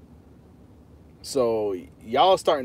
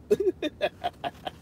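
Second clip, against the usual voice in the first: laugh; 12 semitones higher, 5 dB quieter than usual. Real, not acted. Laughs after the joke.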